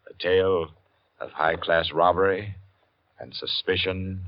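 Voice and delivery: measured, dramatic announcer read, slowing to a hush; radio announcer intro. Acted. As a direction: build suspense like an old radio host.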